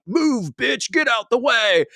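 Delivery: high mock yell from a tailgating sleigh; mock road rage. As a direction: bark it like a cartoon road-rager. Voicing Santa ramming her car.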